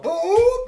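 very high, loud "boop"; dropping sound effect. Acted. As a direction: squeal out a cartoon drop noise. Imitates toy being dropped.

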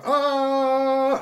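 high, loud falling scream; falling cry. A narrator's reading. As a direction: cry out as if dropping on a string. Toy dangles down like yo-yo.

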